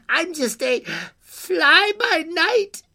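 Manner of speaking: high, loud, drawn-out creature voice; impish fly-by-night. Acted. Creature introduces itself to girl, high and drawn out. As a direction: announce it slowly and gleefully.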